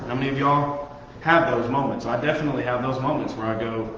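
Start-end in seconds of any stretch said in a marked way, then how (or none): none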